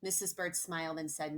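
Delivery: ordinary delivery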